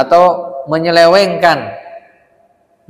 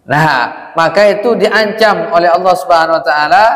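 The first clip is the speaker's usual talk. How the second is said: ordinary talk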